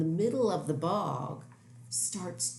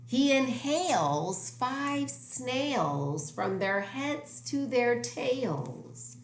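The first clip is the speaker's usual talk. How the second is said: slow dramatic; 7 semitones higher, slower than usual. A narrator's reading. Dramatic slow story line.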